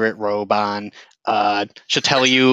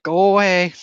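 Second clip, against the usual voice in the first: a faint, frightened plea from the corner; barely there, pleading to be left alone; 7 semitones higher than usual. Acted. Hidden woman pleading faintly to be left alone. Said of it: "You can hear a voice. It's really faint".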